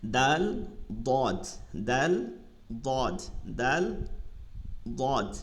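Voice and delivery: দাল light and thin, দদ heavy and full; Arabic dal thin, dad heavy, in contrast. Shown as a demonstration. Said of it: "চিকন আর মোটা".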